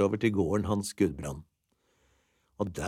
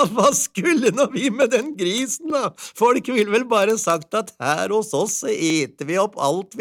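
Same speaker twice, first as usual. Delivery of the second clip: put-on very high, excited old-tale housewife with little laughs; delighted wife; 13 semitones higher, 5 dB louder than usual. A narrator's reading. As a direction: gush in a high voice, chuckling between thoughts.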